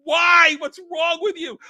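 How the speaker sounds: high, loud outburst; hammed-up anguished demand; mock distraught yell. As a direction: cry out in overacted outrage. Acted outburst at the death scene.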